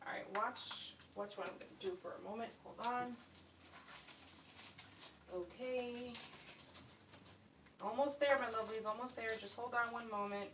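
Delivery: faint, distant voice from across the room; talking while walked away from the camera; far from the mic. Real, not acted. Crafter talking to viewers from across the room, far off. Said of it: "I don't know if you can still hear me".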